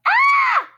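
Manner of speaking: very high, loud startled shriek; shrill startled yell; frightened scream. Acted. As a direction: scream like you got the fright of your life. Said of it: "His father turned around and yelled".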